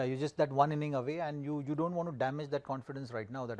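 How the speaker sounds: ordinary talk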